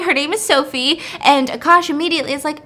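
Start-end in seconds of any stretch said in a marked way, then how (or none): none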